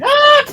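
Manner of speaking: high, loud shrieking scream; fairy shrieking as it pops. Acted. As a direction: let out a piercing cry. Fairies exploding, scream.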